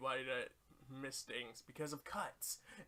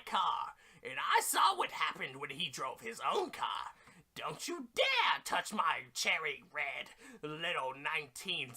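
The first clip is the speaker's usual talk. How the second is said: loud, shouting nagging boss; scolding boss; 2 semitones higher, 6 dB louder than usual. Pretend. Mission boss shouting at Goku to listen, loud nagging rant. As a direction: yell at Goku like a furious nag.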